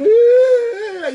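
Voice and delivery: high, loud mock sob; imitated crying wail. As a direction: wail like a crying kid, hammed up. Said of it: "llorando like that".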